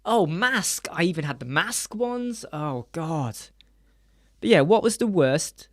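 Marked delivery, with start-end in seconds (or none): none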